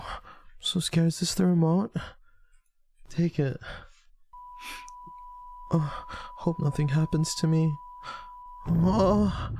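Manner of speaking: high, moaning fake fear; hammed-up high-pitched fright; pretend scared. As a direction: act frightened in a high, drawn-out voice. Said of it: "I'm trying to be scared".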